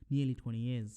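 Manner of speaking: normal talking voice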